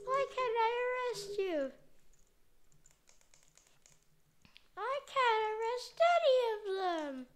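high, whining shouts of frustration; high whining complaint; whiny frustration. Pretend. Failing to arrest as police.

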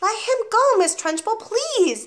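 high, urgent plea; distressed teacher imploring; begging. A narrator's reading. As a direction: beg desperately for the child's release. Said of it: "begged Miss Honey".

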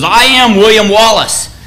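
rally frightened soldiers from horseback; declaiming a defiant war speech to the army; Wallace rallying troops. Retold or imitated. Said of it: "And he says".

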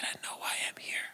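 soft, hushed, certain; whispered realisation. Retold or imitated. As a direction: breathe it out like a revelation. Quiet moment of realisation.

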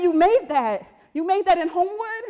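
loud, high-pitched imitation of astonishment; amazed disbelief. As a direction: play the neighbour who can't believe it. Acting out amazed neighbours' questions.

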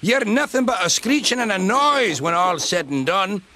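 high, heated scolding rant; angry outburst. A narrator's reading. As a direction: burst out scolding, pitched high throughout. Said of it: "Donald burst out".

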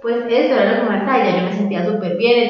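usual speaking voice

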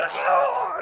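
very high, loud shriek; wife grabbed by the demon, high hammed-up scream; mock scream. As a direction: shriek as if dragged away. Grabbed by the throat.

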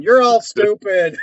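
mock announcement. Banner for duped winners at radio station, mock jeering. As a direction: proclaim it slowly to a whole crowd.

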